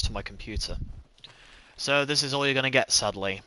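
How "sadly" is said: normal talking voice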